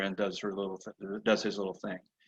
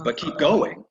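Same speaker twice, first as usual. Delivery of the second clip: firm; 8 semitones higher, 5 dB louder, slower than usual. Retold or imitated. Rider insisting on pace.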